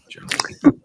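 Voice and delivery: whispered "General Murphy" as a joke; mock whisper. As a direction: breathe "General Murphy" teasingly without voice. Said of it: "I'm making fun".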